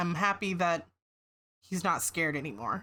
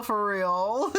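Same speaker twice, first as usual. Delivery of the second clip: teary laugh; 9 semitones higher than usual. Real, not acted. Laughing through tears.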